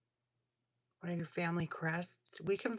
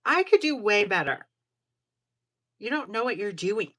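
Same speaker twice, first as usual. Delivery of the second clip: scoffing boast; 3 semitones higher, 10 dB louder than usual. Retold or imitated. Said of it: "It's like".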